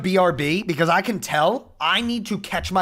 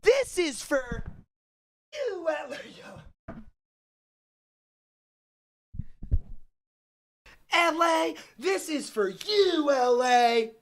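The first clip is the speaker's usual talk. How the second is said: high, slow, distant chant; dramatic dedication; 9 semitones higher, 3 dB quieter, slower than usual. Pretend. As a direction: call it out slowly to the city.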